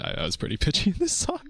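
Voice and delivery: giggling up high; laughing. Real, not acted. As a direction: laugh through it. Laughing at own pitchy singing.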